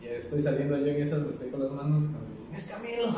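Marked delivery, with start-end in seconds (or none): 2.5-3.1 high-pitched mock fan cry; pitched-up retold squeal of recognition; excited exclamation. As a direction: imitate an excited stranger spotting a star. Retold fans spotting a celebrity lookalike.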